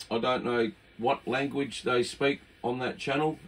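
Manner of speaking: ordinary talk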